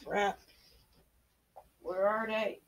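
muttering to self. Real, not acted. Muttering to himself while searching.

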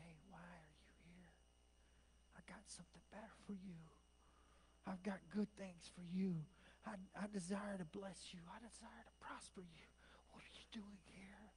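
intimate, very soft whisper; still small voice. Acted. God getting up close in your business, whispering. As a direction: whisper it like a private promise. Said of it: "speaks to us in that still small voice".

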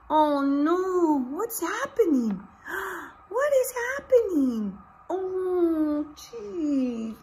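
high, rising gasping alarm; mock alarm. Teacher hamming up shock for the class, high pitched. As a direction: act shocked for the kids.

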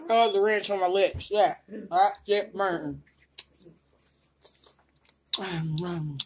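normal talking voice

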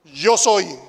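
loud solemn "Yo soy"; booming divine name. Shown as a demonstration. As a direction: thunder out "Yo soy" as God's name. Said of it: "Usó el nombre".

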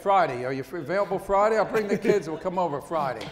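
loud, eager rush of invitations; eager self-invite. Retold or imitated. An eager guest pushing to come over Friday, loud and keen.

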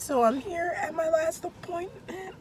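high, strained voice with barely any sound; voice giving out. Real, not acted. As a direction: talk through a lost voice, pitched high. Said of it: "I can't talk".

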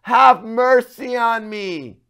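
loud, high, very slow pleading cry; drawn-out desperate shout to Jesus. Shown as a demonstration. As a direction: pray out loud like you mean it. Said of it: "to cry out and say".

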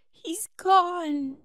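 high, loud tearful cry; sobbing. Acted. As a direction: blurt it out through tears. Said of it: "Then Winnie sobbed".

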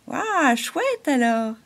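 slow, drawn-out amazed exclamation; amazed wow. A narrator's reading. The halo marvels at the butterflies, drawn-out wow. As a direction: marvel at it slowly.

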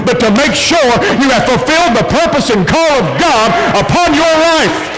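high, driving pulpit delivery building to a peak; fervent sermon crescendo; full-force preaching. Acted.

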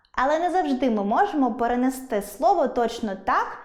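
ordinary talk

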